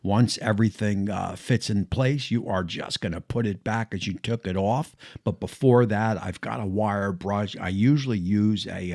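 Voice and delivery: usual speaking voice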